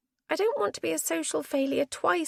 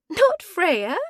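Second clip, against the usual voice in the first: surprised; 7 semitones higher, 8 dB louder than usual. Acted. Surprised at mistaken identity.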